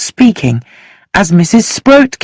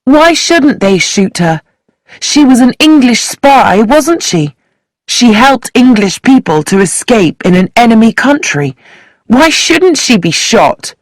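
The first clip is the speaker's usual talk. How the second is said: demanding; 6 semitones higher than usual. Acted. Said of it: "Sheila demanded".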